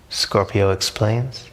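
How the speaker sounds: ordinary delivery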